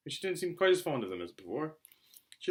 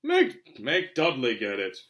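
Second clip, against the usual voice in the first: mock protest; 7 dB louder, much slower than usual. Harry pushing the chore back onto Dudley, loud protest. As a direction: bounce the order straight back.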